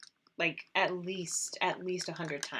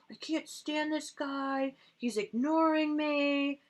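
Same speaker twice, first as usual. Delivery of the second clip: upset Blake; 7 semitones higher, slower than usual. Retold or imitated. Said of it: "He just got really upset".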